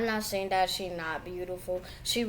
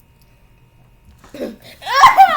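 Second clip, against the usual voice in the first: high squealing giggle; loud ticklish shriek; shrieking laugh; 16 dB louder than usual. Real, not acted. Child tickled by mother.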